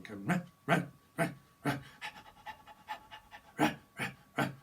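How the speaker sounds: barking dog noises, rah rah. Retold or imitated. Psychic reader voices a dog barking camera. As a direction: bark it out like a dog. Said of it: "this dog going".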